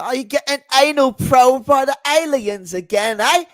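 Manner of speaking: hammed-up high, loud British sneer; mocking the alien believers in a British accent. Man mocks alien believers in a hammed-up British accent. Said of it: "Oh, what's his accent?".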